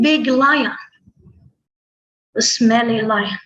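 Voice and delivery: ordinary delivery, nothing marked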